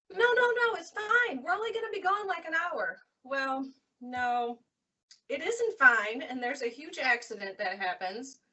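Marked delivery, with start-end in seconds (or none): 0.1-3.0 higher, breezy brush-off; dismissive boyfriend. Retold or imitated. Boyfriend pilot brushes off her worry about rain, breezy and higher. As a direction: wave her worry away lightly.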